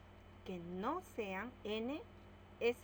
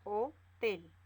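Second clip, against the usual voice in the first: Hotel split into ho and tel, louder; syllables ho-tel; 7 dB louder than usual. Shown as a demonstration. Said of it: "Separamos en sílabas". (separando Hotel en ho-tel, con fuerza)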